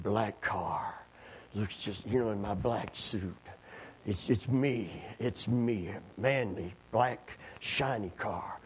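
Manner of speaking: a hint of macho showing off, slow at the end; mock manly swagger.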